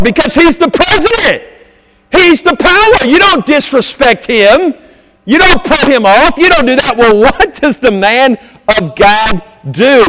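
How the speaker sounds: loud, high, ringing preacher's voice; fired-up Sunday sermon cadence; preaching at full force. Acted. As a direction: preach it out to the whole congregation.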